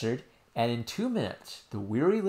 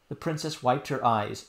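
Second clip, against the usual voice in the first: plain reading voice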